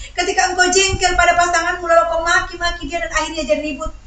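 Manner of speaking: high, loud, insistent sermon voice; forceful preaching. Real, not acted. Preacher warns congregation against giving anger an opening, forceful preaching. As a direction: drive each question home to the congregation.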